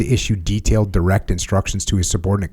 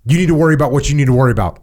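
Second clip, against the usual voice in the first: dismissive browbeating boss; 4 semitones higher, faster than usual. Retold or imitated. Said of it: "that little act".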